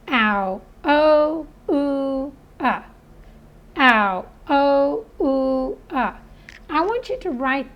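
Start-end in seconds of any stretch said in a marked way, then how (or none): 0.1-6.2 "Ow. Oh, ooh, ah" as separate held vowels, punching "Oh"; phonics sound drill. Shown as a demonstration. Teacher drilling the four sounds of O U, chanted drill. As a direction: drill each vowel sound on its own, evenly spaced. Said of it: "I want you to hear the sounds".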